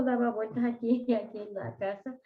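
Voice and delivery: ordinary talk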